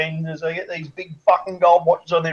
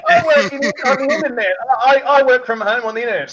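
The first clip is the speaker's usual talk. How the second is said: much higher, put-on dopey voice; mock stoner voice; 7 semitones higher than usual. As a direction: parody a lazy guy defending himself. Mocking stoner's excuse.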